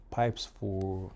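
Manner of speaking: ordinary talk, nothing marked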